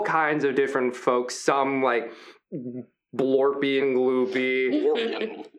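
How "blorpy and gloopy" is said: drawn-out description. A narrator's reading. GM describing odd crowd creatures.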